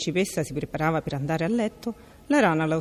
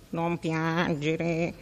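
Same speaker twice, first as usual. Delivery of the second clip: very slow croaky frog voice; croaking frog; much slower than usual. A narrator's reading. Frog comforts the weeping princess, slow croak. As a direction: croak it out slowly like a pond frog. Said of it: "gracidò la rana".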